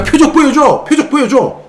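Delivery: shrill mock chant of the sign-seekers; demanding signs. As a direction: mimic a crowd crying for signs. Mocking people demanding signs.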